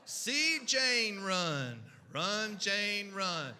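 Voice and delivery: slow, stilted primer reading, each word drawn out; early reader read-aloud. Acted. First-graders reading Dick and Jane aloud, slow and stilted. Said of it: "So we would read about Dick, Jane, and Spot".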